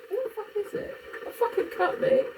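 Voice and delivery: high, incredulous swearing; what on earth is this, high; baffled. Real, not acted. Puzzled streamer asking about a cup, high and baffled.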